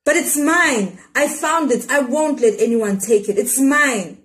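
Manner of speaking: put-on girl defending the parcel she found, insistent; stubborn insistence. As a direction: clutch it and refuse to give it up. Child clings to found parcel.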